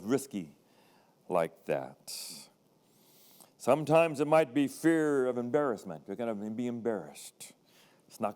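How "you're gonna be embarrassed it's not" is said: soft, hushed warning; whisper. Acted. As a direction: whisper it like a doubt in your head. Voicing the fear's inner voice.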